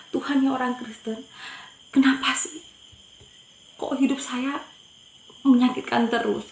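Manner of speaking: retold hurt plea. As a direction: complain to God in mild anguish. Retold hurt complaint to God.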